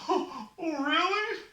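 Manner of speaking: high, whimpering question; tearful hope. Acted. Sufferer hears Raven's guarantee, high tearful hope. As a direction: ask hopefully through sobs. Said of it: "Don't cry".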